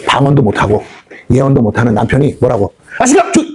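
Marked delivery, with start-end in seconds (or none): 3.0-3.4 high-pitched, cut-off scolding of a husband; 不耐烦地呵斥. Acted. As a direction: act out a wife shutting her husband up, pitch jumping up. Wife snapping at her husband.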